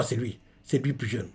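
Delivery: ordinary delivery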